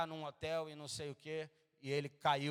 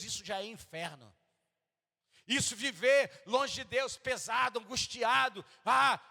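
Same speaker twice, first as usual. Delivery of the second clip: preaching at full force; 7 semitones higher, 5 dB louder than usual. Acted. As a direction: cry out to the congregation about hell. Preaching climax on hell.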